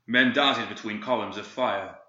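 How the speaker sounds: plain reading voice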